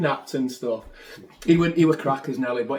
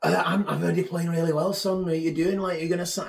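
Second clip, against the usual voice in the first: put-on Scottish accent; 5 semitones higher than usual. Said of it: "the Scottish guy from Fleetwood".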